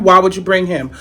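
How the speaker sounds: ordinary talk, nothing marked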